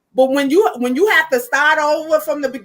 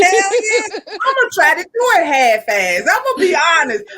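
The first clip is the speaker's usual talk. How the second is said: laughing; 5 semitones higher than usual. Real, not acted. Laughing confession.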